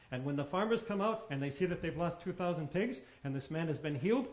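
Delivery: ordinary talk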